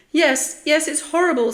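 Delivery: high, loud panicky outburst; alarmed, high-pitched cry; frightened agreement. A narrator's reading. Spike agrees, hiding in fear.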